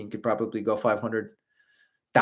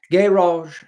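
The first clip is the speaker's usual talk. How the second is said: posh British GARage, higher and louder; 7 semitones higher, 8 dB louder than usual. Shown as a demonstration. Streamer showing chat his British "garage". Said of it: "in a British accent".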